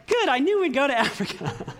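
playful imitation of an excited wife; giddy and excited. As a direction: mimic his wife's giddy delight. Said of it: "she's all giddy and all excited".

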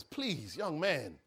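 low, hushed begging; soft plea. Acted. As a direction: gently ask for a moment. Governor softly begs prisoner.

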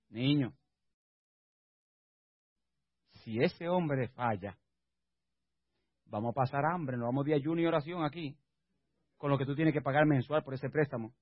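low, soft warning; imitated wife's caution. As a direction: voice a wife quietly cautioning her husband. Wife cautioning him about loan.

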